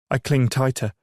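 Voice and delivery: usual speaking voice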